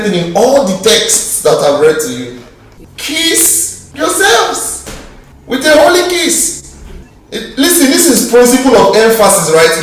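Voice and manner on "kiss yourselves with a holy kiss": very slow, high "Kiss yourselves"; emphatic command. Acted. Preacher driving the Bible's instruction home, emphatic. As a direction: draw out each word of the command.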